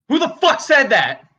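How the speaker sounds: high, angry yell at a heckler; furious demand. Acted. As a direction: explode at the unseen heckler. Comedian challenges heckler, escalating.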